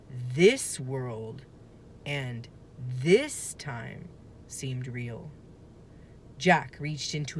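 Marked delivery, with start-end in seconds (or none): none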